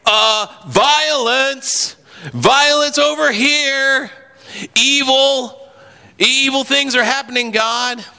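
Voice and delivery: high, slow anguished plea; Habakkuk crying out. Retold or imitated. Preacher voices Habakkuk crying out, high anguished plea. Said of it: "opens up with Habakkuk saying".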